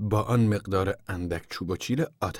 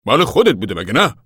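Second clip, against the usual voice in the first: loud, higher-pitched challenge; insistent demand; 5 semitones higher, 10 dB louder than usual. A narrator's reading. Father questions uncle about the money, loud and insistent. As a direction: press him loudly for a yes.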